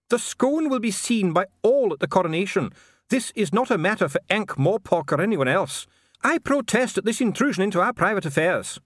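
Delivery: high, agitated dwarf dignitary; protesting dwarf official. Acted. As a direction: protest indignantly in a high-pitched voice. Dee protests the intrusion.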